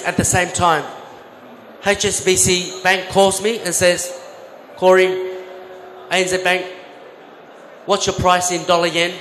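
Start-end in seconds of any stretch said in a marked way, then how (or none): none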